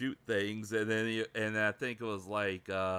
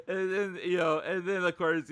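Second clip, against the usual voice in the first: ordinary talk, nothing marked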